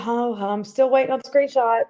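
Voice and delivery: normal talking voice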